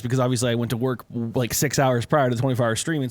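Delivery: normal talking voice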